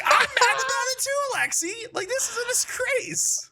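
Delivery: mock outrage. Teasing Will in front of the server, hammed-up outrage. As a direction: pretend to be scandalized, playing along.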